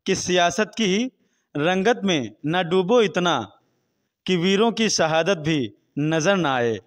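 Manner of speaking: projected, measured shayari delivery; poetry recitation. Real, not acted. Interviewee recites couplets on hunger and voting, projected recitation. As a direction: declaim the verse phrase by phrase, with pauses.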